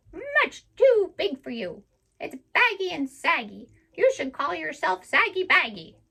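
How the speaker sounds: high, loud, teasing parrot voice; mocking parrot. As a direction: squawk out the insults like a saucy bird. Parrot mocks Suki at length.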